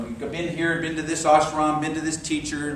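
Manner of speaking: usual speaking voice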